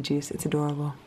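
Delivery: soft unvoiced whisper. Real, not acted. Mother near her sleeping husband, whispered. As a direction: whisper so he stays asleep.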